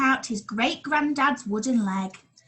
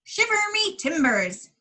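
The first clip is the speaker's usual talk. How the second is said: pirate exclamation; 9 semitones higher than usual. Acted. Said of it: "he exclaimed".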